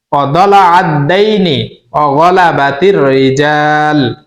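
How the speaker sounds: slow, measured Arabic recitation with drawn-out vowels; teaching dua recitation. Shown as a demonstration. Teacher showing listeners the dua to memorise, slow careful recitation. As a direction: recite slowly phrase by phrase, pausing after each. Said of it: "আমার পড়ার দিকে খেয়াল করবেন".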